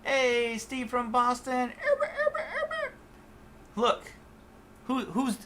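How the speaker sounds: half-hearted stab at a Boston guy's voice; failed Boston accent. Pretend. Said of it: "how do I do a Boston accent?".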